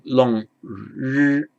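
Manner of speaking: R held out as a long, steady hum; long syllabic R. Shown as a demonstration. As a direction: draw the R out long after "long".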